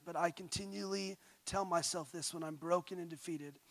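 ordinary delivery

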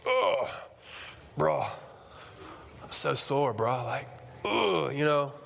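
groaning gym bro voice; sore gym bro. Retold or imitated. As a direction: moan like a sore lifter. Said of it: "guys that come in, they're like".

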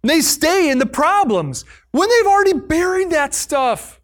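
normal talking voice

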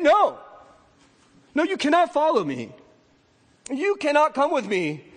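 loud, high, decisive no; firm refusal. Acted. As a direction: turn him down gently but firmly. Jesus refusing the man.

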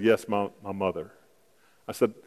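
ordinary delivery

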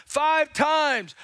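ordinary talk

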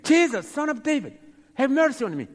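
loud, high begging shout; acted scream. Blind man screaming again to passing Jesus, acted loud plea. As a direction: cry out over the crowd, imploring. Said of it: "And he screamed again".

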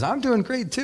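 match the other's cheer; cheerful reply. Retold or imitated. Stranger answers the pastor at the counter, cheerful reply.

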